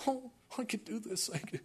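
high, soft put-on voice; eager angel. Angels coming down wanting the job, eager high voice. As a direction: play a straining little angel, pitched well up.

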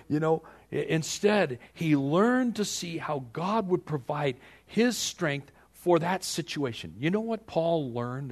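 ordinary talk

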